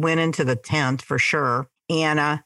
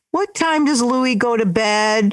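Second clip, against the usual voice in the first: higher, slow singled-out kiddie question; child voice, pitched up and slowed; little kid's question; 6 semitones higher, 3 dB louder, slower than usual. Retold or imitated. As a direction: imitate a cute young student asking. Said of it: "Those little voices were so cute".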